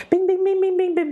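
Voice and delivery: high, bright-pinged little bing noises; ping each bing up high like a bell going off; playful chiming bings as ideas connect; bing sound effect. Retold or imitated. Ideas suddenly clicking into place.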